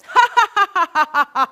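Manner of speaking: a loud, high burst of real laughter; laughing. Real, not acted. Leading the laughter exercise, a loud real laugh.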